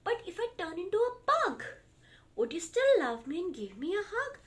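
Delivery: put-on high little child voice; pleading child. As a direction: ask it like a toddler wanting a hug. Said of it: "Small said".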